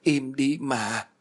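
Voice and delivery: slow, tearful begging; whimpering plea. Acted. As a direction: drag it out through sobs. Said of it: "nó bắt đầu khóc mếu máo".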